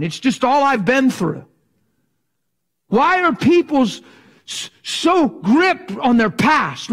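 high, loud, slow preacher's cry; preaching at full force. Acted. Preacher pressing the congregation, at full force. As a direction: preach it out to the crowd.

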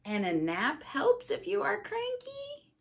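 a sweet helpful pig tip; Piggy, lightly voiced. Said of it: "says the piggy".

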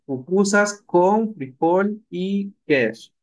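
slow recap of the phrase; teacher repeats the gloss slowly. Shown as a demonstration. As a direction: stress con in Pupusas con frijol y queso. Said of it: "el iwan lo ocupamos como con".

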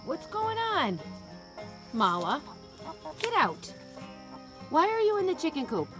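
ordinary delivery

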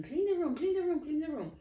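high, loud nagging yell; yelling mom. Acted. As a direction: yell it like a mom walking in on a messy room. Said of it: "starts yelling".

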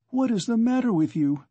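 high alarmed cry of a mother. A narrator's reading. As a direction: call out in sudden alarm. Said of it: "cried his mother".

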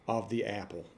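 normal talking voice

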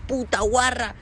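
mock crude rapper. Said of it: "hablas de denigrar a las mujeres".

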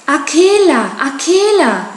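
calls out the name high and loud; calling out. A narrator's reading. Mowgli calling to his wolf friend, loud call. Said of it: "rief Mowgli aus".

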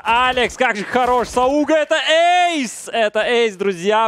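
screaming with hype at the ace; excited shout. Real, not acted. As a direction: roar it out like a caster at a big play. Ace called by caster, screaming.

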